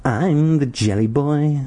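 put-on high, slow sing-song creepy kid; weird sing-song. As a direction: croon it like an eerie nursery chant. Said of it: "he said in a weird sing-song".

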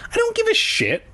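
very high-pitched outburst; squealed protest, way up high; exasperated cry. A narrator's reading. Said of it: "I cried".

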